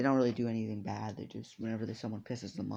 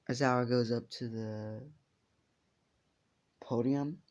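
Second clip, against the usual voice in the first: ordinary delivery, nothing marked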